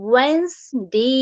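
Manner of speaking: "ওয়েন্সডে" slow, "wenz" then a long "dey"; model pronunciation. Shown as a demonstration. Teacher spelling out the sounds, slow model word. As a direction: pull "ওয়েন্সডে" apart into two syllables. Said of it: "আমি pronunciation word গুলো বলে দিচ্ছি".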